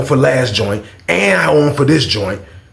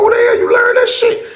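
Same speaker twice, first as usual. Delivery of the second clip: amazed. Retold or imitated. Asking his roommate where he learned that, amazed shout. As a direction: cry it out in disbelief.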